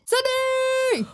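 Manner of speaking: high, slow announcer call; sportscaster voice. Acted. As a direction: stretch the name out like a ring announcer. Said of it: "you sounded like a, like a sportscaster".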